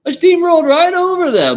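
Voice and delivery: high, loud, laughing brag; excited laughing brag. Real, not acted. Boasting to viewers about debates, in a high loud laughing brag.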